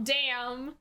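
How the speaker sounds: ordinary talk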